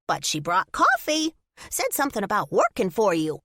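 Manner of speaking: squirrel voice. Acted. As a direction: chatter it like a squirrel. Squirrel character voice.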